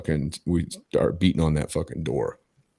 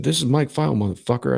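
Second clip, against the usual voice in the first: raised-pitch defiant retort; retold angry comeback; 7 semitones higher, 4 dB louder than usual. Caller answering the frat guy who grabbed phone, retold defiance. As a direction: re-enact squaring up over the phone.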